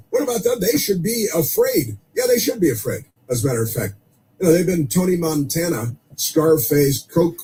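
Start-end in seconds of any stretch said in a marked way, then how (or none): 0.1-3.1 indignant outburst. Real, not acted.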